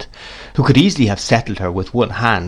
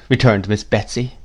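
ordinary delivery

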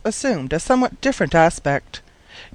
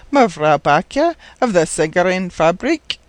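ordinary delivery, nothing marked

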